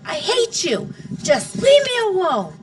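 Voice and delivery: put-on high, loud girl's scream. A narrator's reading. Daughter screaming at her father, high and loud. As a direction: scream it at him and run. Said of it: "I screamed".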